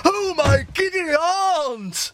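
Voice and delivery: high, drawn-out panicked cry; hammed-up cry of panic. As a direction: wail in comic alarm. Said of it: "he looks very panicked".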